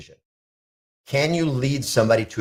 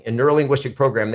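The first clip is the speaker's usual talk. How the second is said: ordinary talk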